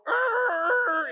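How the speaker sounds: a sudden, very high, loud startled cry; panicked yell. Retold or imitated. Panicked slamming the brake.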